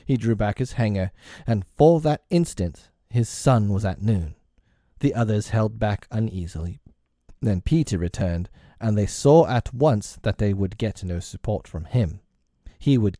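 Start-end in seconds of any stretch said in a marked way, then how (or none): none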